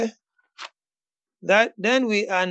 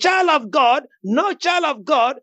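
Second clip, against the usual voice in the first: raised, emphatic preacher's declaration; fervent preaching; 5 semitones higher than usual. Real, not acted. Preacher urging a friend to declare life over Vanessa, fervent preaching. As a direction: preach it with bold authority, pitched up.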